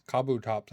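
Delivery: Kabutops as "KAH-boo-tops"; alternative pronunciation. Shown as a demonstration. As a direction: lean on a full "kah" to open Kabutops. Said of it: "I've heard Kabutops".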